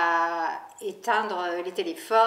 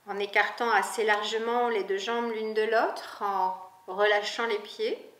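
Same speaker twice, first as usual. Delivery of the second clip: guided meditation. Acted. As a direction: lead the listener gently into rest, unhurried. Guided Yoga Nidra reading.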